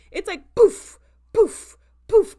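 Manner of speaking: loud high mock pops; popping sound effect. Vlogger mimicking heads popping, loud pops. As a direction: punch out each poof like a bang.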